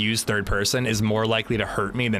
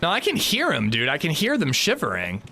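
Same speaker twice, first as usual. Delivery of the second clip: ordinary talk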